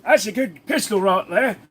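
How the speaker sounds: high-pitched Aussie bloke voice; exaggerated Australian accent; put-on Australian accent. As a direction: keep up the high Australian character voice. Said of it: "I just switched way down".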